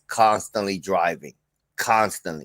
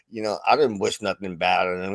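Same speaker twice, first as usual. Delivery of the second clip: ordinary talk, nothing marked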